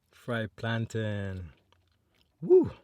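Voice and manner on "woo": sudden high delighted woo; excited whoop. Real, not acted. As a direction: yelp with joy at the food. Excited about fried plantain.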